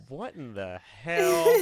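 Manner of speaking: loud, high, slow outraged disbelief; shocked disbelief. Real, not acted. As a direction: blurt it out stunned and appalled. Shocked by odd chat message.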